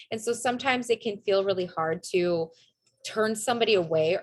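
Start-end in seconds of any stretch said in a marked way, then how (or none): none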